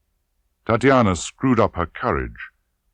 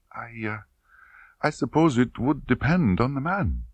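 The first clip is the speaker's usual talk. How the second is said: put-on girl unsure under questioning; shy young woman; 7 semitones higher than usual. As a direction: answer haltingly. Tatiana answers.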